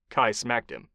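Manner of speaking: ordinary delivery, nothing marked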